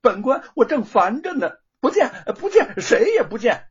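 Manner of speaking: loud, high irritated dismissal. Acted. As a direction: snap him away. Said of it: "没好气儿地说".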